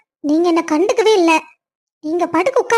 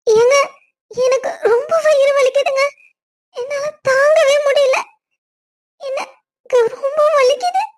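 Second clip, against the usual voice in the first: high, whimpering fake pain cries; faked stomach pain; 7 semitones higher than usual. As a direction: pretend to be in agony to get his attention. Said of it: "நான் சும்மா சொன்னேன்". (பொய்யான வயிற்று வலி அழுகை)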